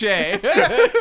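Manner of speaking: loud, high burst of laughter; big high cackle; real laugh. Real, not acted. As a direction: erupt laughing at a comeback. Laughs at the comeback.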